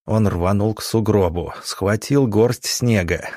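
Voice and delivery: plain reading voice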